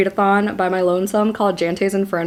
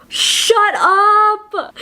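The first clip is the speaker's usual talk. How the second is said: excited shriek; 11 semitones higher, 5 dB louder than usual. Real, not acted.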